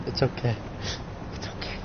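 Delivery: very soft reassurance; soothing. Acted. Character comforts an upset friend, soothing. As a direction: fade to a hushed comfort.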